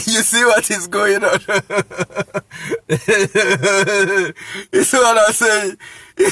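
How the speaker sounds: talking through chuckles; laughing at your own point; real laughter. Real, not acted. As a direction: break into a long, amused laugh between phrases.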